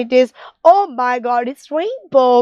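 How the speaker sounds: ordinary delivery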